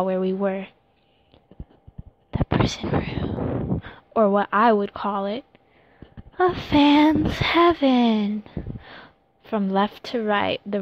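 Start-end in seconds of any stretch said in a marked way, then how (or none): 2.3-3.8 dramatic whisper. Acted. Dramatic reveal. 6.4-8.4 high, loud, slow awe; awed. Acted. Dramatic reveal.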